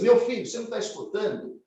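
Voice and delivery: high, loud, rapid parental reproach; scolding father. Acted. Father scolding child, acted quote.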